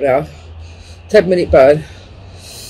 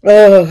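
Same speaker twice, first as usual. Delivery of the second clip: loud pained groan; 4 semitones higher, 8 dB louder than usual. Real, not acted. Chilli burn pain.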